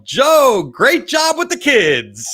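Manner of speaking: high, drawn-out shout across a room; mock yell. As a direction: yell it as a teasing heckle, stretching the words. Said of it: "I yell".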